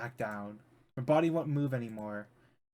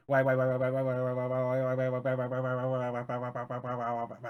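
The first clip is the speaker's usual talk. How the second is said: spiral into a panicked loop; frantic repetition. Acted. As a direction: manic chant of why, dying away. Frantic repeated why.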